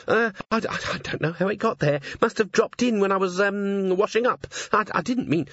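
high, tumbling, caught-out stammer; blurting excuses for the silver spoon; flustered Cherie. A narrator's reading. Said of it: "the words tumbled over themselves".

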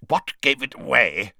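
grunting question from a character getting up; narrator's grunting character voice; grunted. A narrator's reading. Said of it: "he grunted".

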